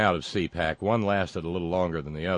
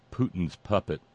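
ordinary talk, nothing marked

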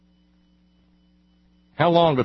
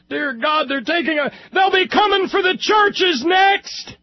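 hammed-up alarmed shout, much higher and louder; mock panic; 3 dB louder than usual. Host mocking the guest's fears with a hammed-up panicked shout. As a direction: cry out like the sky is falling, tongue in cheek. Sarcastic panic over a takeover.

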